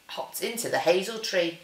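ordinary delivery, nothing marked

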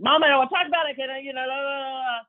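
put-on high, loud whine of an upset teenage son; upset whining son. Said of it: "would become completely emotionally dysregulated".

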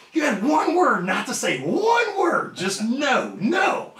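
high, slow emphatic retold outburst; exasperated scolding. Wrestler scolding his partner after the meeting, exasperated. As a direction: drive each key word up high.

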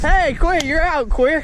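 high call across the yard. Real, not acted. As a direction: call him out of the game. Calling out hit player.

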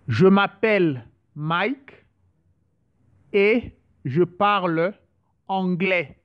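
slow, even model sentence for learners; slow practice phrase. Shown as a demonstration. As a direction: say "Je m'appelle Mike et je parle anglais" slowly as one smooth sentence. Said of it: "some few sentences just to, to practice".

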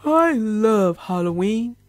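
slow, drowsy voice through a yawn; sleepy yawn. Acted. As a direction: say it while yawning at bedtime. Gave a big baby hippo yawn.

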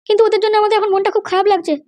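a high female character voice; mother's voice. Acted.

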